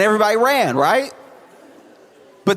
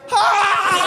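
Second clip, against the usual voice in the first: short mock scream. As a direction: yelp it out in fake fright. Someone screams on mountain.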